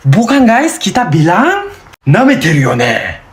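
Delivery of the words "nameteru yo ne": fast, put-on confrontational voice; insolent challenge. Calling out rudeness in Japanese, fast and confrontational. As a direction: challenge someone who disrespects you. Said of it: "Kalau kamu kurang ajar".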